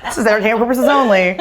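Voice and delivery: high, giggling fake legal notice; laughing mock disclaimer. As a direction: rattle off the disclaimer through laughter.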